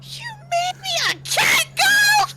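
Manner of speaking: high, shouting in disbelief; outraged shout. Real, not acted. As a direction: yell it, half laughing in outrage. Outraged at character design.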